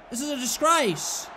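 ordinary talk

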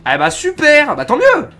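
loud, high-pitched surprise; excited outburst. Real, not acted. Streamer reacting to aliens speaking French, excited outburst.

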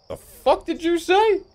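high, slow indignant squawk; hammed-up high-pitched outrage; mock outraged heckler. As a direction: squeal it in mock offence. Acted reaction to his pronunciation.

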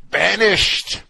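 high, very loud cry of banishment; mock outraged decree. Host mocking banishment to a hot blacktop, loudly proclaimed. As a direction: proclaim exile at full voice, hammed-up. Repeats the mock sentence, louder.

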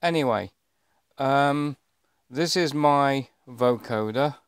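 ordinary talk

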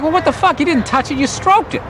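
high-pitched mock outrage; retold indignant protest. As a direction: argue back, voice pitched right up. Retold argument with girlfriend.

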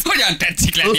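high-pitched comic question; mock polite greeting. Host asking after Bözsi néni, mock polite high voice. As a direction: ask it in a playful high put-on voice.

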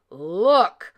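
loud papa bear growl; one loud burst of papa bear; great big bear growl. A narrator's reading. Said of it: "growled Papa Honey Bear in his great big bear voice".

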